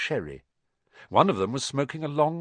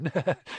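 nervous laugh; 5 semitones higher than usual. Acted. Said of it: "He laughed nervously".

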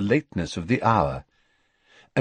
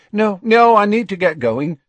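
louder, higher hurried refusal; Tonks refusing; 11 semitones higher, 6 dB louder than usual. A narrator's reading. Tonks refuses to stay, avoiding Dumbledore's eyes, louder and hurried. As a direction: brush them off and hurry away.

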